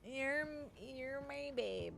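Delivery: slow, soft, drawn-out odd voice; weird put-on voice. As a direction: croon it slowly in a strange made-up voice. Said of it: "I don't know what that voice is".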